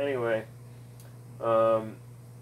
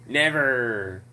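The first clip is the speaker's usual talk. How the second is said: "Never" said through laughter, louder; chuckling as he repeats "Never"; laughing never; 3 dB louder than usual. Retold or imitated. Repeating "Never" to mock the endless war, laughing.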